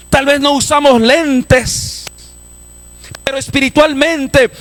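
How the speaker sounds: high-pitched, driving sermon delivery; fervent preacher in full cry; full-force preaching. Acted. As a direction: preach it hard, pitched far above normal talk.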